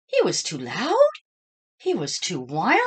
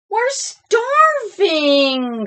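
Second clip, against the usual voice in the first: high, loud, slow hungry whine; whining friends; 12 semitones higher, 8 dB louder, much slower than usual. A narrator's reading. Hungry friends complaining to Clark, high drawn-out whine. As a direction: whine it out long. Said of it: "said his friends".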